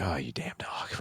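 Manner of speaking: low, under-the-breath grumble; irritated muttering. Retold or imitated. Dad drying off a snowy dog, irritated mutter. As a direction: curse it quietly to yourself. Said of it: "I'm already irritated".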